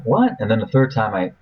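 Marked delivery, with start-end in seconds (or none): 0.0-0.3 high rising puzzled what; high, short confused what. Retold or imitated. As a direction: ask it in sudden confusion. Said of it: "I'm like".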